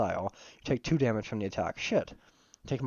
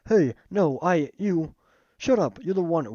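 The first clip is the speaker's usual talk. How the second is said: loud shout; 6 semitones higher, 5 dB louder, slower than usual. Acted. Character angrily cuts someone off.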